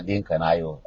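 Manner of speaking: usual speaking voice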